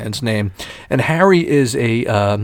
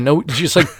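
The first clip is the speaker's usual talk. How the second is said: ordinary delivery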